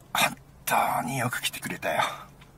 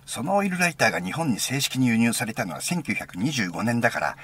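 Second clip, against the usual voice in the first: imitated enthusiast, high swooping pitch; excited rattling-off; 4 semitones higher than usual. Friend arguing the lighter's history, imitated excited rattling-off. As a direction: gush out the facts as his excited friend. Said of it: "お前、そうまくし立てたんだよ。".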